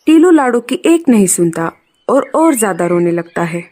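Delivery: ordinary delivery, nothing marked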